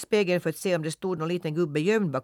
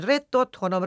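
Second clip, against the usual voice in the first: higher, louder hen-like rätt åt honom with åt stressed; hens jeering; 6 semitones higher, 5 dB louder than usual. A narrator's reading. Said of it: "skrek hönsen".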